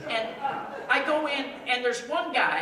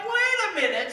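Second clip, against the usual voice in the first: alarmed realization; 8 semitones higher, 4 dB louder, faster than usual. Retold or imitated. Realizes he's in wrong city.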